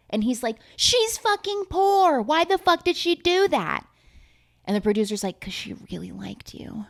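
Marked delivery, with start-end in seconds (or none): 0.7-3.8 high-pitched appalled squawk; hammed-up disbelief, pitched way up; mock outrage. Retold man's outrage at her sacrifice. 5.4-6.8 hushed, confiding whisper; soft dramatic whisper; mock-tender whisper. Producer's tender reveal, whispered.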